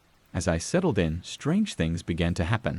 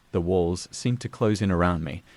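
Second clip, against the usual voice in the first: plain reading voice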